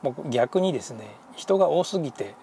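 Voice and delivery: ordinary delivery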